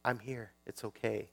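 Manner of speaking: put-on calm a crying child gently; soothing parent. Parent hushing a child after a nightmare, soft and soothing. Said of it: "the mom or the dad says".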